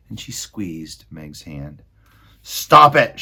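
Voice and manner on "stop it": higher, loud shout; Roscuro shouting. Acted. Rat shouting at the girls, loud. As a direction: bark the order. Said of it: "shouted Roscuro".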